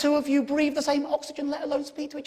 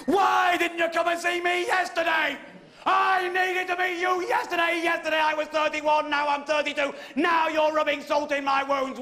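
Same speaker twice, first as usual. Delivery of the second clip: angry outburst; 4 semitones higher than usual. Retold or imitated. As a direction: vent anger at the stranger. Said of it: "I could not conceal my anger".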